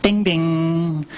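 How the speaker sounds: mimicking a ship intercom chime, sung out; ding-dong like the ship's PA; PA chime imitation. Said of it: "It's gonna be something like this".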